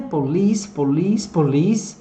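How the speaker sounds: "police" repeated with stress on its second syllable; pronunciation drill. Shown as a demonstration. Teacher drills "police" for learners, carefully, each with a rise. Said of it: "de pronunciar aqui".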